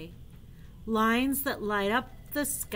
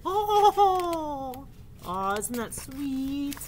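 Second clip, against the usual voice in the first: ordinary talk, nothing marked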